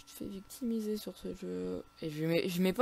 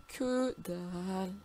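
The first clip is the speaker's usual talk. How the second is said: very slow, stretched syllables; long, drawn-out grumble; dejected drawl; much slower than usual. Real, not acted. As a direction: drag out both words in disappointment. Dejected at getting nothing.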